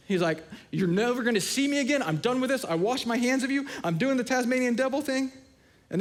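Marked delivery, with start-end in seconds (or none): 0.7-5.3 higher, hammed-up fit of a huff; mock tantrum. Preacher acting out Paul's tantrum, hammed-up and higher. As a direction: play a storming-off tantrum for laughs. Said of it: "He throws this massive fit".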